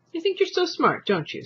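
sneer. A narrator's reading. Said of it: "sneered his father".

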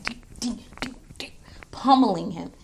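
whispered sound effects. Real, not acted. Describing Reigns pummeling Jey, whispered effects.

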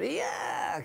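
high mock yell of yeah; Paul's shout. Recalling Paul at the record's end, high mock yell. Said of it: "you can hear Paul shout out".

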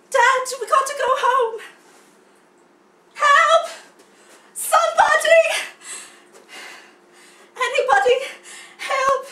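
high, loud stage cry for help; hammed-up panicked young cub; desperate calling out. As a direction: shout out for rescue, overacted. Acted scene, cub calls for help.

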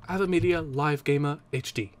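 put-on British accent, R's dropped. Shown as a demonstration. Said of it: "say it like in a British accent".

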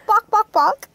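put-on high, loud British-accented barks; posh-dog British barking. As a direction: bark it out in British. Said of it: "You gotta bark in British".

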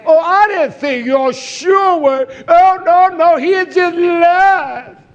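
high, slow, drawn-out mock voice; hammed-up sweet believer voice. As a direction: parody a sentimental churchgoer. Mocking a soft believer.

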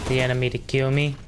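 usual speaking voice

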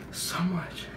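soft whispered aside; hushed whisper. Real, not acted. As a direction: breathe it out to yourself.

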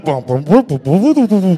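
oompah tuba noises with the mouth; punchy mouthed band booms; imitated brass band. The band playing behind the quarrelling couple, imitated tuba oompah. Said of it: "Ahí está la banda tocando".

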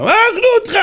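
very high mock-panicked exclamation; alarmed outcry. Captain warning the rabbi about the lions, alarmed outcry. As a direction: cry out in hammed-up alarm.

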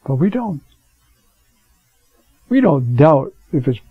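normal talking voice

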